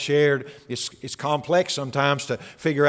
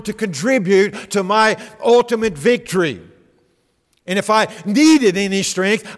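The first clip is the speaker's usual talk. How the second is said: raised, fervent preaching voice; louder and higher, pressing each point home; Jesus rebuking Peter; 5 semitones higher, 5 dB louder than usual. Acted.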